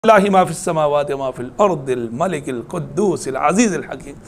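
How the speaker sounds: Quranic recitation. Acted. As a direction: draw the words out in tilawat. Preacher recites Quran verse.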